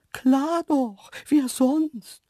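high, loud, cocky animal voice; cheeky antelope. A narrator's reading. Antelope answering the surprised man, cheeky.

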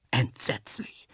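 breath taken away by horror; horrified whisper. A narrator's reading. Narrator picturing animals running wild, whispering in horror. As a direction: whisper it, aghast.

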